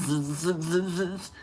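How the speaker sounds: repeated buzzing z sound; buzzing z drill. Shown as a demonstration. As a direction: drill the z, z, z like speech therapy. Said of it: "I had bad problems with my S's".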